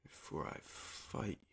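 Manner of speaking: unvoiced, slow threatening whisper; menacing whisper. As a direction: breathe the threat softly. Creepy game line whisper.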